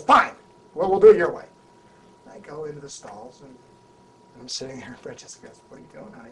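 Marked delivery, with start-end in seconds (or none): 0.0-1.4 loud higher clipped giving in; concede, loud and put out; miffed concession. Retold or imitated. Hoffman gives in, argument. 5.7-6.3 soft unvoiced aside; hushed aside. Francesca whispers to him.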